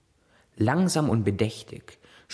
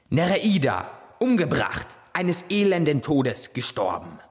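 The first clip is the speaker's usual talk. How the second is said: empörte Klage; 6 semitones higher than usual. Acted. Poseidon klagt an.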